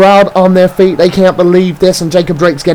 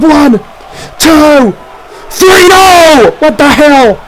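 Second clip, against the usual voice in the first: can't believe the kickout; screaming commentator at a near fall; shocked shout; 8 semitones higher, much slower than usual. Acted. Unexpected kickout at three.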